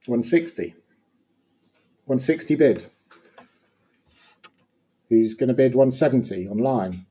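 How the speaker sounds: brisk auction-room bid calling; fine art auctioneer. Acted. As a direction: call the bids like an auctioneer.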